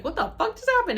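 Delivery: high, loud exclamation; retold laughing disbelief. Retelling to those who helped her up, high amused blurt. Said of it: "I start laughing".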